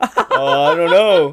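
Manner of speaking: loud, laughing impression of a lame excuse; mock the guy's dodge while cracking up; laughing through the guy's feeble answer; laughing mimicry.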